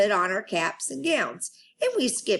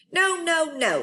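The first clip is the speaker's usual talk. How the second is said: upset protest; 10 semitones higher than usual. Acted. Lucille protesting to teacher.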